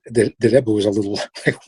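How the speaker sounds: ordinary talk, nothing marked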